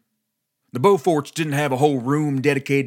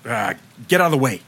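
barked; 2 semitones lower, faster than usual. A narrator's reading. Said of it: "barked Stanton".

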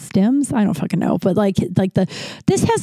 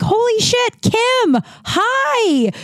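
much higher, delighted burst of surprise; excited greeting; much slower than usual. Retold or imitated. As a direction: mimic a friend thrilled to bump into you, pitched way up. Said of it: "And he's like".

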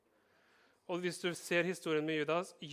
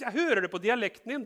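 "Jeg hører det på dialekten din" said high and raised, pressing; insisting; 5 semitones higher, 5 dB louder than usual. Acted. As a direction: press the point, louder. Accuser insists Peter is Galilean. (høy, pågående stemme)

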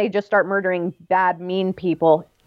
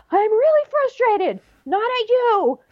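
much higher, hammed-up exasperated voice; mock frustrated spouse; 13 semitones higher than usual. A spouse telling her partner it's the situation, in a high mock-frustrated voice. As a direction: play a frustrated spouse, strained and high, insisting it's not personal. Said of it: "being like".

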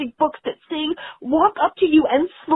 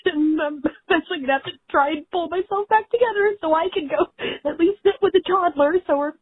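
ordinary delivery

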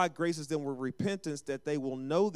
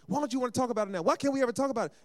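frustrated spouse; 8 semitones higher, much faster than usual. Retold or imitated. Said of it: "You get in an argument".

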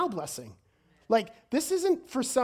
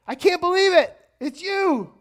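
high, loud burst of astonishment; amazed recognition; 10 semitones higher, 10 dB louder than usual. Acted. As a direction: exclaim it in joyful disbelief. Acts out Thomas recognizing Jesus.

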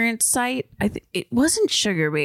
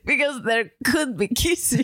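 mock Russian accent; Russian spy; 3 semitones higher than usual. Replaying the kissy line, hammed-up Russian-spy accent. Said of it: "a Russian spy".

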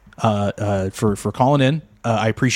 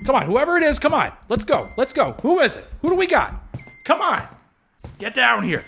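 nervous bravado; 12 semitones higher than usual. Acted. Facing an unseen intruder in dark.

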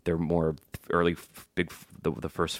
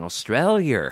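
exaggerated Australian accent, pitched up; comic Australian accent; 6 semitones higher, 4 dB louder, slower than usual. Dad making his kid laugh with a higher Australian accent. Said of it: "trying to make my kid laugh, and I was saying".

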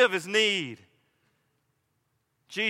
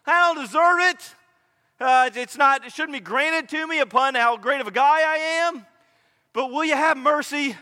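high, loud begging for mercy; urgent plea; 7 semitones higher, 5 dB louder than usual. Acted. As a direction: plead at raised pitch and volume. Voicing the beggar asking for mercy.

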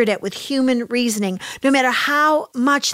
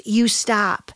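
usual speaking voice

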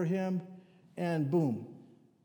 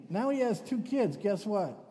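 ordinary delivery

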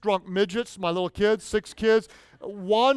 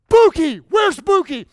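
put-on loud, high frantic call for a lost child; panicked call; 9 semitones higher, 10 dB louder than usual. Father at the beach calling for his missing little son, panicked and loud.

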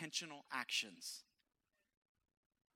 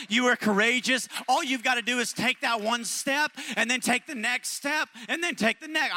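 loud, higher, rallying preacher climax; peak preaching; 6 semitones higher, 11 dB louder than usual. Acted. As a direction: lift them up at the top of your voice.

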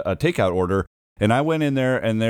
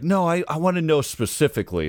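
insistent customer; 6 semitones higher than usual. Retold or imitated. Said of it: "she's like".

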